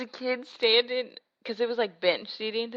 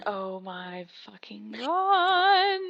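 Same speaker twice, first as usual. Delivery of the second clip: very slow drawn-out disbelief; much slower than usual. Retold or imitated. Retelling her reaction to the boy at the wedding, very slow disbelief. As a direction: stretch every word out in shock. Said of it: "I was just like".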